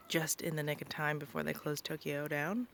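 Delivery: normal talking voice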